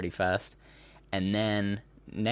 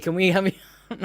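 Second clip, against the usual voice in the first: high, loud, rushed mock request; mock eager clamouring; 11 semitones higher, 6 dB louder, much faster than usual. Host mimics people rushing to book [name], mock eager. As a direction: blurt it out like over-keen media.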